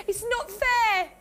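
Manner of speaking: tearful complaint, breaking into sobs; crying. Real, not acted. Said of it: "I feel like I want to cry".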